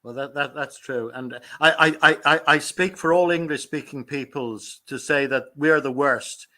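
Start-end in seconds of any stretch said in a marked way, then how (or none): none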